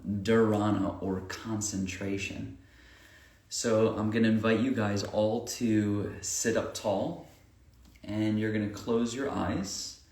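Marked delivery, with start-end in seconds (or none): 3.5-10.0 unhurried breathing guidance with long pauses; guided meditation. Acted. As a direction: lead them through each breath, leaving room between phrases.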